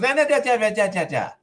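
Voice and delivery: high, rattled-off nonsense syllables; mock someone's talk as high babble; mimicked chatter. Cop questioning the other driver, mocking high babble.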